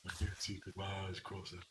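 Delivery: low quiet mumble. Retold or imitated.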